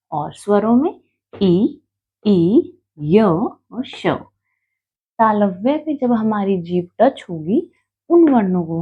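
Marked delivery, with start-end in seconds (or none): none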